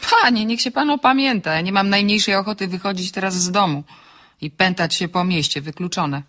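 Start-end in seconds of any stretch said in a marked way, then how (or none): 0.1-1.5 higher-pitched indignant rebuke; indignant protest. Acted. Woman refusing a late-night meeting, indignant. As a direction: protest at an outrageous suggestion. Rejects a midnight street meeting.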